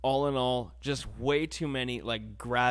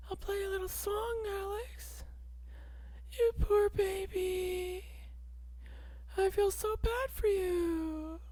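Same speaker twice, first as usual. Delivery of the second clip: put-on much higher, slow soft baby-talk; mock cooing; 4 dB quieter than usual. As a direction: soothe poor little Alex like a baby. Cooing at himself about sad times.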